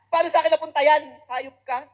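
loud, high furious outburst; angry shout. Retold or imitated. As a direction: roar it in outrage. Yelling at a pickpocket. (galit na sigaw sa mandurukot)

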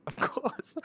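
high, airy mocking giggle; high squeaky mock laugh. Mocking the accent, high giggly squeal.

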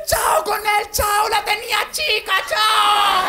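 high, slow mock shout; drunk yelling. Separated woman shouting at the disco about her ex, high mock yell. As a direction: yell it out like a tipsy woman on the dance floor. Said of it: "empieza a gritar".

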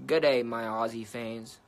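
mock Australian greeting to the fans; horrible Aussie accent. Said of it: "That was a horrible Aussie accent".

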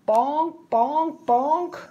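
high, evenly punched bonks; comic impact words. A narrator's reading. Narrator acts out pins hitting head.

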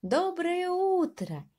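high, slow, sing-song good morning; cheerful mouse greeting. Acted. Mouse greeting the hares, high and drawn out. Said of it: "Всем белкам сказал".

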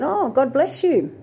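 high, slow, sugary "God bless you"; sweet blessing. Retold or imitated. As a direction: lay the blessing on extra sweetly. Greeting a hostile coworker.

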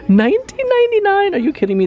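can't believe how cheap it is; delighted high squeak of disbelief; shocked at the price. Real, not acted. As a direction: squeal the price way up high, then "Are you kidding me?" still high and loud. Shocked at a low price tag.

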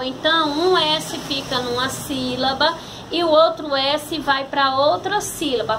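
ordinary talk, nothing marked

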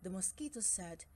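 ordinary delivery

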